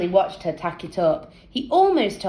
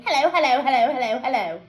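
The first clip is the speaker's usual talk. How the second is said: teasing; 6 semitones higher, faster than usual. A narrator's reading. Teasing Jeff repeatedly.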